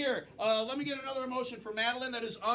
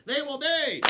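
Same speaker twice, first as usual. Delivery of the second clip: loud, high catchphrase lead-in; announcer cue; 6 semitones higher, 8 dB louder, slower than usual. Real, not acted. Host prompting audience to finish rule, announcer cue. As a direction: belt it out to cue a crowd.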